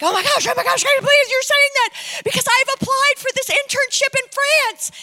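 loud, high, breathless gushing; mock-ecstatic high-pitched outburst; imitating an excited woman. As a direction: squeal it like she can't believe her luck. Retelling woman's excited reaction to prophecy.

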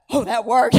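shocked delight. Retold or imitated. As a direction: blurt it out, amazed it worked. Baby silenced after his command.

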